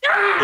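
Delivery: sudden high mock scream; short high-pitched yell, acted out; imitated scream. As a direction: mimic a prankster's scream. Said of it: "He screams".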